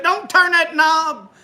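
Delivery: slow, high mock warning. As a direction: hold him back from the knob. Ralph warns, retold.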